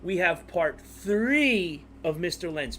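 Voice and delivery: ordinary talk, nothing marked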